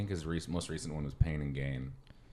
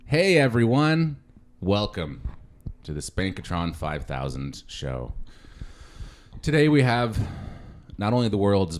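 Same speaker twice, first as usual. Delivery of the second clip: show host intro; 5 dB louder than usual. Acted. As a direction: announce the show like a game host.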